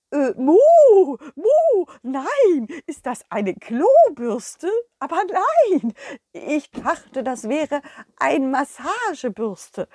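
high, mooing cow with a proud tone; a sweet cow showing off her find; cow puppet. Acted. The cow Kurita proudly presenting her little plate.

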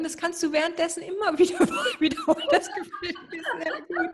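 laughing through the words; giggling, voice jumping up. Real, not acted. Teasing request, laughing.

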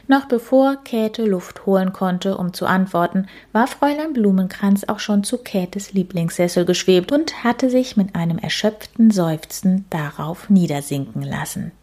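ordinary delivery

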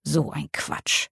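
mother doubting the video call, slow and soft; disbelieving. A narrator's reading. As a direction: mutter it in disbelief. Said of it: "Sie klingt ungläubig".

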